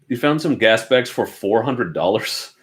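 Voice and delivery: ordinary talk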